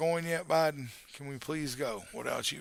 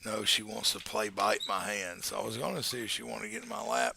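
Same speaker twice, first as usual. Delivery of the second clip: ordinary delivery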